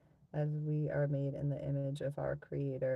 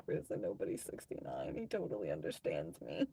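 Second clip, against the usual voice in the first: low mock voice; 11 semitones lower, 5 dB quieter, faster than usual. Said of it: "I was like".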